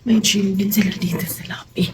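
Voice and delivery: hushed whisper. Real, not acted. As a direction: whisper it to the camera. Whispering in hotel lobby. (pabulong na sabi)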